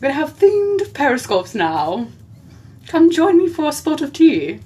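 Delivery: high, hammed-up British accent; British lady. Inviting viewers to tea in a mock British lady voice. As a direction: play a grand British hostess. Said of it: "British lady".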